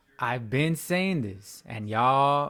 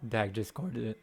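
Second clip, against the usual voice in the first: voice crack; 3 semitones lower, 7 dB quieter than usual. Real, not acted. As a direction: voice cracking mid-sentence. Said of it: "Sorry for my voice crack".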